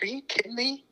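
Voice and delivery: high, incredulous imitation of the accountant; accountant's astonished reaction, imitated; mock disbelief. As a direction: play the shocked accountant. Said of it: "He's like".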